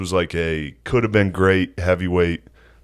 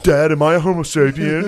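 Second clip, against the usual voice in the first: put-on kid voice; 9 semitones higher, 4 dB louder than usual. Voicing kid asking dad.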